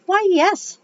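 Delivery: put-on southern accent. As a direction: answer like a flattered Southern lady. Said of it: "I gave her a southern accent".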